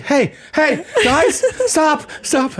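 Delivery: high, panicky pleading; imitating a teen panicking under the kids; mock panic. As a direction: squeal it like a grown man losing it. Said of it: "you see him go from one to ten panic".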